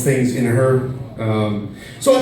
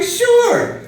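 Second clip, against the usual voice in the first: squeaky high mock eager sure; mock cheerful sure; 3 dB louder than usual. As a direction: chirp it high and overeager, hiding dread. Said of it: "I'm like".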